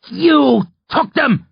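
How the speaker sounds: high, slow, hateful hiss; strained, drawn-out venom; hissed accusation. A narrator's reading. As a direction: hiss it out with fear turning to hate. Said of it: "he hisses".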